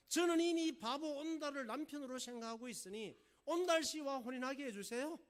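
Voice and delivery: high, loud, determined plea; acted stubborn daughter; insistent princess. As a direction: state it firmly to a royal father. Princess firmly pleading with the king.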